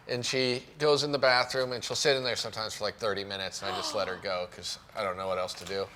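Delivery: ordinary delivery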